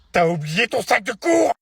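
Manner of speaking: loud, high, incredulous yell; furious shout. Acted. Parent to child about the forgotten bag, furious. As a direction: shout it in outraged disbelief.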